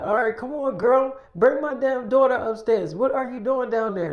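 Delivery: acting out an impatient parent calling downstairs. Parent calls girl to bring daughter upstairs, impatient.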